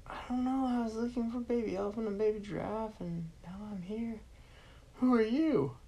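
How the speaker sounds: put-on boy answering the villain in a high voice; scared little boy. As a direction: babble nervously to the stranger.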